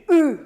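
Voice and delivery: short, loud U pushed up from the diaphragm; loud, effortful grunted U; strained Russian vowel. Shown as a demonstration. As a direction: squeeze out the hard Russian vowel written U. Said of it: "pero es así".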